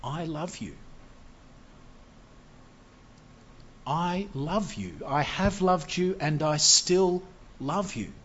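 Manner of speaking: soft, slow, tender declaration of love; softly tender, said slowly; God's tender love. Acted. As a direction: hush it, like a father reassuring. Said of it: "But he says".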